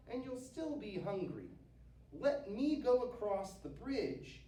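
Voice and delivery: high, tiny pleading goat; little goat talking his way out of being eaten; little billy goat. A narrator's reading. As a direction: plead in a small high-pitched voice.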